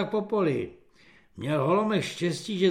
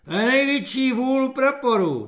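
scornful sergeant; 8 semitones higher, 5 dB louder than usual. Acted. Sergeant scoffs.

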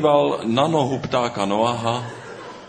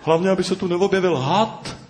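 much higher, fast fretting voice; worried; 9 semitones higher, faster than usual. Acted. Bird Noha fearing a snake in town, anxious high fretting. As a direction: fret nervously about the snake. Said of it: "strachoval se Pták Noha".

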